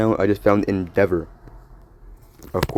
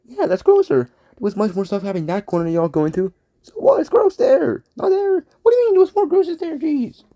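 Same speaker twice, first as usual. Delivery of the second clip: squeaky figure. Acted. Little figure bickering with the others about gross corners, squeaky. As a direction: squeak back in a petty argument.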